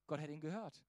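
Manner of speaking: ordinary delivery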